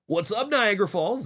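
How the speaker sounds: high, bright crowd greeting; cheery mock frontman; stage shout-out. Shown as a demonstration. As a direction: greet the venue by name. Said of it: "That would've been cool".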